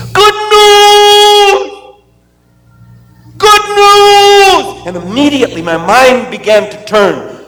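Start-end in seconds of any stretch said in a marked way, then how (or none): 0.1-4.9 yelling Bernie. Retold or imitated. Bernie yelling across camp to the boys. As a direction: call it out from across the camp, high and thrilled. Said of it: "he was yelling this".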